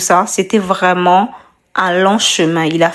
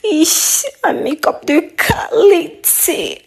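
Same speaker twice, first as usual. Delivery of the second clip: excited; 8 semitones higher than usual. Real, not acted. Admiring the finished look.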